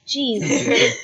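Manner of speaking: lightly voiced gremlin, a touch put on; Pud voice. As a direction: slip into the Pud voice for one word. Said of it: "I'm gonna put on the Pud voice again".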